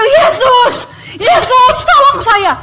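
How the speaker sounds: high, loud cry for help; desperate shouting. Retold or imitated. Kneeling in the street calling Jesus, desperate shouting. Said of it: "Teriak-teriak".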